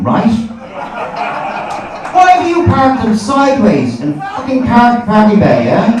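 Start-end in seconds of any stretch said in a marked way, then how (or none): 2.1-5.9 high, loud indignant challenge; slow, exasperated demand at the drivers; ranting in mock outrage; comic rant. Ranting at smart car drivers.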